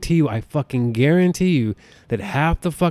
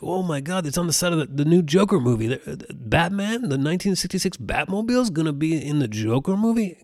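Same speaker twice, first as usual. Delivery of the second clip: higher, gushing fanboy voice, lightly hammed; mock excited fans; 5 semitones higher than usual. Mocking online fans gushing over the Batmobile, lightly hammed-up. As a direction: parody breathless fan excitement. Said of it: "people are like".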